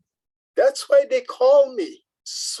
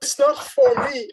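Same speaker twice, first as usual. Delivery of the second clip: high pleading denial; 14 semitones higher than usual. Acted. Caught thief pleads.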